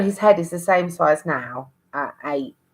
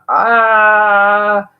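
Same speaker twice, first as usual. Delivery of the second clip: high, loud mock cry of alarm; a sharp cry in labour; pained cry; 5 semitones higher, 7 dB louder than usual. Baby stuck, panic.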